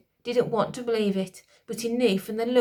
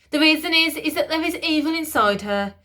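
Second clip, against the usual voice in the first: high, loud cutting in; high loud interjection; Lord Protector interjecting; 7 semitones higher, 6 dB louder than usual. A narrator's reading. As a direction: cut in forcefully. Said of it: "interjected the Lord Protector".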